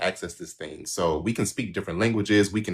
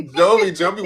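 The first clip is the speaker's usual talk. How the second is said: excited call; 10 semitones higher, 7 dB louder than usual. Real, not acted. Calling out to Jovi excitedly.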